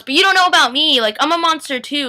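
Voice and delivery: high, loud, defensive retort; heated protest. Acted. Tyler argues with his mom over Caroline, heated protest. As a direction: push back hotly. Acted son argues back.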